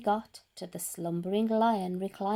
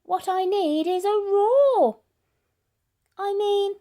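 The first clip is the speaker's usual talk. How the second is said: high, louder mouse voice; excited mouse; 9 semitones higher, 7 dB louder than usual. Acted. Mouse in bed planning to roar, high and eager. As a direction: squeak it out with excitement. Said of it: "he said".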